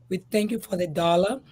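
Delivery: ordinary talk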